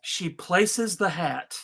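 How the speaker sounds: usual speaking voice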